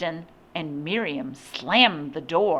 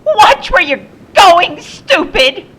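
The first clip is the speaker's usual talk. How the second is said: high, loud, screechy scolding; shrill and screechy; 13 semitones higher, 12 dB louder than usual. A narrator's reading. Woman to the man who bumped her, shrill and screechy. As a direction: scold shrilly at full volume. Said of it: "It was shrill and screechy".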